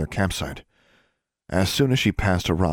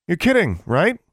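high, loud incredulous protest; appalled disbelief; 12 semitones higher, 6 dB louder than usual. A narrator's reading. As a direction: blurt it out, appalled. Alexandra appalled by the whispered suggestion.

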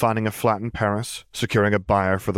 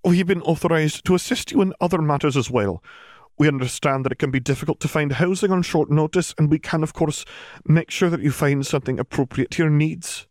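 official voice; 8 semitones higher than usual. Acted.

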